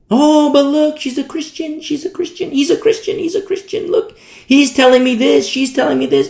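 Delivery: high, insistent mock pleading; mock protesting believer. Naive believer defends the counsel to the preacher, mock protest.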